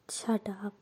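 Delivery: hushed, breathy shut up; embarrassed whisper. A narrator's reading. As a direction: whisper it so nobody hears. Embarrassed friend hushes teasing. (शर्माते हुए फुसफुसाकर)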